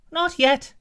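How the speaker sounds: apple answers the giant in a very high squeak; talking apple. Acted. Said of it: "said the apple".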